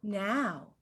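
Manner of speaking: a soft, low little "Now." mimicking him; mock stammer. [name] mocking Troy's first "Now", soft and low. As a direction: say "Now." softly, as his first stumble. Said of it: "You just said two nows".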